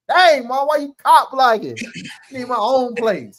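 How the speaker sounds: high, loud whiny complaint; teen protesting to mom. Retold or imitated. Retells teenage protest to mom.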